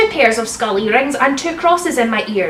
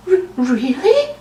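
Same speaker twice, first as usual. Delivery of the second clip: slow stammered mock whimper; a halting, hammed-up whimper. A narrator's reading. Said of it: "he whimpered".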